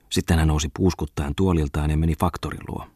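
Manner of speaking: ordinary delivery, nothing marked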